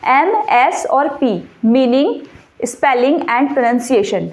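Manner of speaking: each letter slow and deliberate; slow emphasis. Shown as a demonstration. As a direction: spell out M, S and P slowly. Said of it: "focus रहिए, ध्यान से सुनिए". (धीरे-धीरे, एक-एक अक्षर पर ज़ोर)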